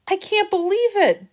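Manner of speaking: high, sobbing-edged disbelief; tearful disbelief. Acted. Jill to Harrison, crying over Chester, tearful. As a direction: voice catching as she fights tears. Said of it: "Jill was saying between sobs".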